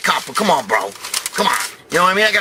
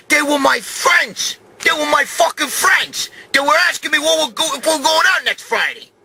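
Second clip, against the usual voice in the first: screaming at the mother-in-law that the cops were his friends; angry yelling; 11 semitones higher than usual. Retold or imitated. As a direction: yell it like you can't believe her.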